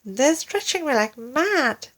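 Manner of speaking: high eager cry; high and thrilled. Acted. Wife reports the stretching, high eager voice. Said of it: "cried Missus Twit".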